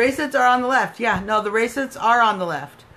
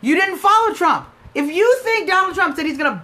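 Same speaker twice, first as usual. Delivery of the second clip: raised, louder, indignant; heated rant; 8 semitones higher, 5 dB louder than usual. Real, not acted. Scolding a fake supporter on the line, heated and loud. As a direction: hammer the caller with disbelief.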